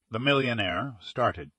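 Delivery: plain reading voice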